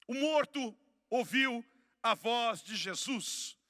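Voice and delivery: slow, loud, phrase by phrase; emphatic proclamation. Real, not acted. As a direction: proclaim it slowly with weight on each word. Repeats the statement, proclaimed.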